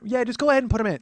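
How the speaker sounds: high, loud imitated aunt; bossy aunt. Aunt telling nephew whom to add, bossy aunt voice. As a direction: boss him around as the aunt.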